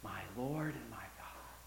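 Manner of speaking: breathless gasp. Acted. Thomas seeing the risen Jesus, awed whisper. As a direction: gasp the words out in awe. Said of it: "flabbergasted gasp as he said".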